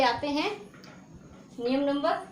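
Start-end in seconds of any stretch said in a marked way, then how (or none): none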